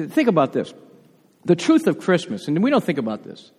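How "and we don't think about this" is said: ordinary delivery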